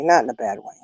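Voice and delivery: grandma softly repeating her point about spoiling; soft echo. Real, not acted. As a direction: say "Not in a bad way" again, dropped softly. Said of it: "and not in a bad way".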